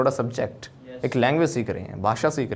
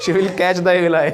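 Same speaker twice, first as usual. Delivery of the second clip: laughing; 4 semitones higher, 6 dB louder, slower than usual. Real, not acted. Joking about casting evil eye.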